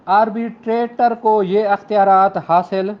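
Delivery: normal talking voice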